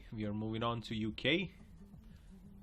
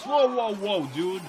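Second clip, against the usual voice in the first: high, loud startled shout; real startled yell, higher and louder; shocked whoa; 8 semitones higher, 9 dB louder than usual. Real, not acted. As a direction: cry out in alarm at the driver. Shocked by reckless driver.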